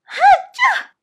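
high, explosive acted "achoo"; loud sneeze. A narrator's reading. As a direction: sneeze hard in a little princess voice. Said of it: "bersin lagi".

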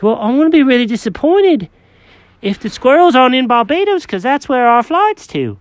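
high, fussy cat voice; Jenkins the cat. Acted.

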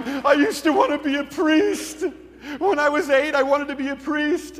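high, slow mock-weeping wail; imitated crying. Atheist weeping in the pastor's office, imitated tearful confession. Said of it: "And as he's crying, he's going".

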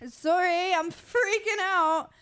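high, hammed-up fake freak-out; mock panic. Co-host teasing friend about panic attack, mock panic. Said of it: "Just kidding".

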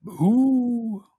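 slow, odd villager greeting; cave dweller voice. Acted. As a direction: greet the stranger warily.

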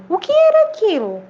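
high, mildly frightened question; startled boy. A narrator's reading. Boy startled by an unknown voice, mildly scared question. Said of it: "O menino ficou assustado".

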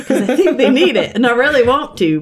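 high, loud plea with a laugh; mock pleading. Pleading with God in retold exchange.

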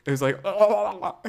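slow, high, odd giggle; recreated nervous laugh. Shown as a demonstration. Recreating her nervous laugh for the host, high giggle. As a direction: show the strange laugh that came out. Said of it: "give us a little bit of a recreation".

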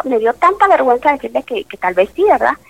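ordinary delivery

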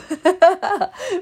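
high laugh after a firm no. Real, not acted. Laughing at refusing to move again, high laugh. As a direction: laugh at her own refusal.

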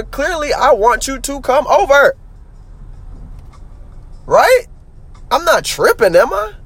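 high-pitched exasperated insistence; heated and incredulous, pitch riding high; starting to snap. Real, not acted. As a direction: argue it out, getting worked up. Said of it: "I'm not trying to snap".

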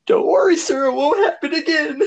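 high-pitched mock apology through laughter; laughing high squeaky. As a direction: squeak it out while cracking up. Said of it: "we were like".

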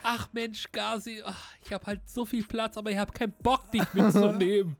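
teasing whiny refusal. Pretend. Teasingly refuses to give a lift.

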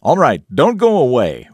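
normal talking voice

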